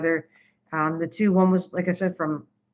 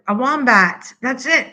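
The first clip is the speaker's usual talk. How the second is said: excited; 5 semitones higher, 5 dB louder, slower than usual. Real, not acted. Guessing the animal name aloud.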